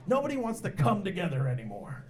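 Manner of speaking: ordinary talk, nothing marked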